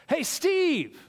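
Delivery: high shouted call across a stadium; a stranger hollering across the ballpark. Acted. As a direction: yell the name from far off. Joke, someone calls out again.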